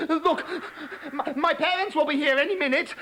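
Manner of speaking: frog protesting in the dark; panicky frog. Acted.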